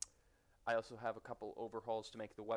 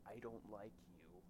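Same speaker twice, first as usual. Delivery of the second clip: quiet; 9 dB quieter than usual. Acted. Mock hostile aside.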